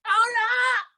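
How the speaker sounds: high-pitched, stretched interruption; exasperated call. Real, not acted. Host halting the glitchy show, high exasperated call. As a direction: cut in with a high cry.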